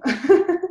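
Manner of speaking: laugh. Real, not acted. Host laughs at awkward moment.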